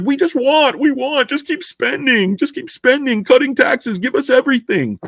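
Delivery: high mocking voice of people who want everything; mock entitled public. Mocking entitled voters demanding endless spending, high mock voice. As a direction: pitch it up and mock their demands. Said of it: "It's like".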